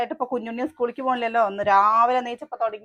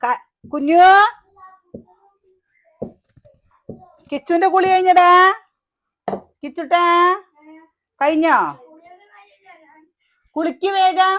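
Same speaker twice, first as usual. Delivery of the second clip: loud, high call across the house; 9 semitones higher, 11 dB louder than usual. Real, not acted. Mother calling to Kichu in the bath, loud high call.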